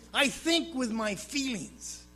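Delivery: ordinary delivery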